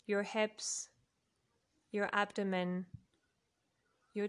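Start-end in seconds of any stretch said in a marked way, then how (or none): none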